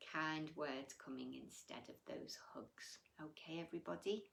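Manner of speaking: hushed closing whisper. Real, not acted. Teacher signing off to the children, whispered. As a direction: quickly whisper the sign-off.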